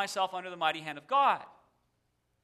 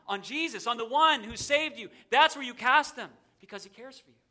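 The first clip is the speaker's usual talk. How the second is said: ordinary talk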